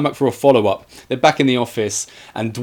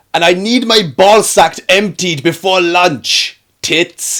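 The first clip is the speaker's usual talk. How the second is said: higher, louder, slow crude boss bellow; mock shout; 7 semitones higher, 6 dB louder, slower than usual. Podcaster retells Bill Boss shouting at Daisy, high loud slow bellow. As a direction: yell it across the office like a lecherous boss. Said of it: "Bill Boss shouts to her".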